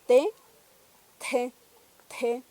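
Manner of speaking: T with a strong puff of air after the t; aspirated t. Shown as a demonstration. Teacher demonstrating an aspirated T to learners. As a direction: release T with a burst of breath. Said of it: "una T aspirada".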